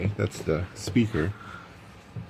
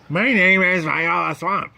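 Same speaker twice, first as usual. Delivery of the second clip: slow, higher, louder mean-teacher voice; 9 semitones higher, 4 dB louder than usual. Acted. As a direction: announce yourself like the meanest substitute. Stern substitute introduces herself.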